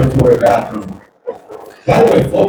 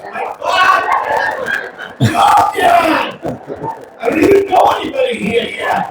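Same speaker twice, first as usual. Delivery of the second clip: daughter's mortified shriek. Retold or imitated. Mortified daughter shrieks at dad in the dorm. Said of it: "She goes".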